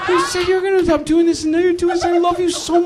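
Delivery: hammed-up husband, pitch shooting very high and fast. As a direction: squeak it out like a wronged husband cracking. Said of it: "You know how guys raise their voice?".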